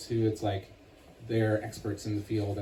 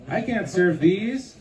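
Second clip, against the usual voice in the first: dismayed character voice; 7 semitones higher, 6 dB louder, slower than usual. Acted. Character upset about ruined treats.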